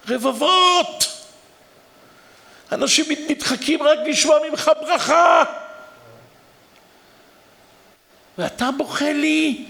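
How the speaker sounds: high, loud, anguished protest; pleading outcry. Retold or imitated. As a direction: cry out to the weeping rabbi, stretching each word. Protesting to the weeping rabbi.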